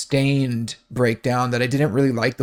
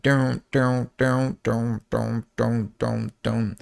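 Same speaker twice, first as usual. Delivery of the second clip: vocalized pulsing synth notes, dropping softer; steady run of sung synth "duns"; synth imitation; 3 dB quieter, much slower than usual. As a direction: mimic a repeating synth riff with the mouth. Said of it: "the synth that was playing at the beginning".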